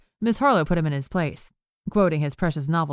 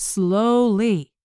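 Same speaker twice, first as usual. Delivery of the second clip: dragged out, mock-slow; slow drawl; 5 semitones higher, 4 dB louder than usual. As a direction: stretch each word to mock slowness. Mocking the slow grocer.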